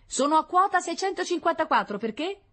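high voice, questioning at the end; scout's radio report. Acted. Scout repeating his altitude for the general, high and puzzled. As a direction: repeat the report, then ask innocently.

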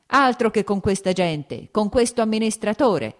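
exasperated master losing his temper; heating up angrily. A narrator's reading. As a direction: scold the steward with rising anger. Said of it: "disse Lievin riscaldandosi".

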